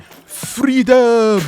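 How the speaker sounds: drawn-out, high mock battle cry; smug mocking shout. Random man taunting teenagers over their accents, smug mocking shout. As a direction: imitate a smug heckler yelling, loud and high. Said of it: "really smug looking, and just went".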